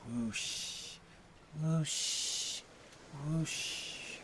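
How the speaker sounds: soft airy whoosh of surf; imitated sea waves. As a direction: make waves wash in and fade out. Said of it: "There should be coastal noises".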